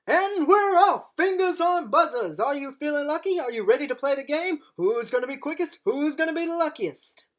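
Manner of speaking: bright, high quiz host patter; game show host. Acted.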